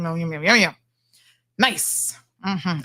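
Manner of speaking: ordinary delivery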